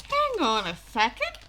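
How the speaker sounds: slow realization. Retold or imitated. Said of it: "her just realizing".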